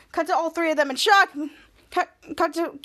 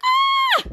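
short, very high shriek; retold squeal of shock; shocked mock scream; 6 dB louder than usual. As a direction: scream high and loud, as if startled. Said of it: "Piper and Charlotte being in shock".

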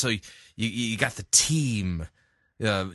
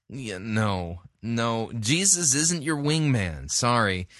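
usual speaking voice

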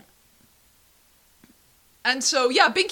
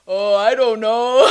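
dopey adult Buster; 8 dB louder, slower than usual. Retold or imitated. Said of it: "And Buster's like".

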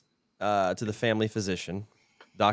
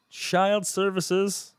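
slow dramatic reveal; 11 semitones higher, much slower than usual. Acted. Dramatic emphasis.

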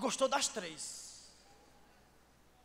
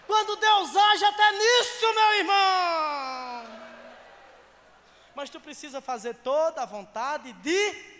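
high, slow preacher's shout; roaring pulpit climax, drawn out; full-force preaching; 6 semitones higher, 16 dB louder, much slower than usual. Real, not acted. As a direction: bellow it out, stretching the key words.

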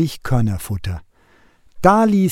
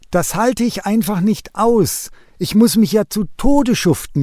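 higher-pitched complaining lament; whining; 7 semitones higher than usual. A narrator's reading. Wren whining to God about the cuckoo chick. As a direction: moan about being worked to death. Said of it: "jammerte er".

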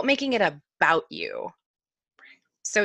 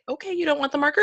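mock patient parent; 9 semitones higher, faster than usual. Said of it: "I was like".

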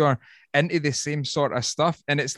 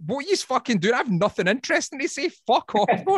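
high, fast mock rant at the crowd; mock exasperation; 9 semitones higher than usual. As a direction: pretend to shoo fans off, half joking. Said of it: "And I'm like".

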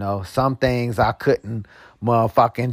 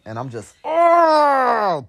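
bubbling over; 12 semitones higher, much slower than usual. Real, not acted.

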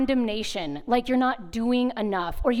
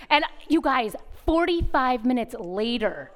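usual speaking voice

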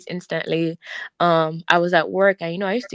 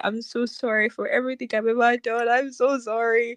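tearful apology, pitched higher; bitter crying; 4 semitones higher than usual. Retold or imitated. Confessing to God while retelling her conversion, tearful. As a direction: choke up as you apologise. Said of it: "I cried bitterly".

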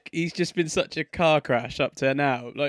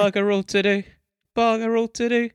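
raised pitch, playful lilt; higher, sing-song repeat; 8 semitones higher, 3 dB louder than usual. Shown as a demonstration. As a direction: toss the phrase up higher each time. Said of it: "repeats it with more pitch".

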